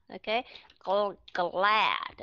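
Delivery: "gl-" then "glad" with both g and l clearly sounded; voiced gl. Shown as a demonstration. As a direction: split off "gl-" first, then say "glad" fully voiced. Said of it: "các bạn phải đọc rõ hai âm đó ra". (đọc rõ "gl-", rồi "glad", cả hai âm hữu thanh)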